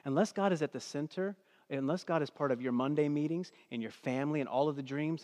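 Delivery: ordinary delivery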